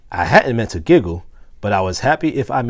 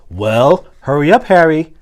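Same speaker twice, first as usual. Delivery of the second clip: impatient, a bit louder; not very happy; 5 semitones higher, 7 dB louder than usual. A narrator's reading. Teacher to Harry, mildly impatient and not happy. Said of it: "in a not very happy voice".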